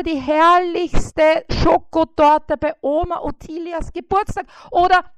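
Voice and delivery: high, loud, self-important fly voice; boasting fly. A narrator's reading. Fly Bissi boasts to the spider about its important appointments. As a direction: puff yourself up listing every duty.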